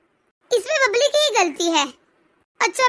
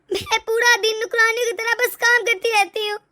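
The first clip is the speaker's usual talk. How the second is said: ordinary talk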